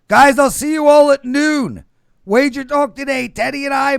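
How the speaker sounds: high, loud, hyped announcer plug; show promo. Acted. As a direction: pump up the next show like an announcer.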